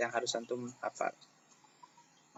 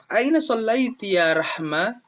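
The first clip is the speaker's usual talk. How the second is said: higher, asking in Arabic; teacher voicing a question to pupil Rahma, higher; 8 semitones higher than usual. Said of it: "Ustaz tanya sama Rahma".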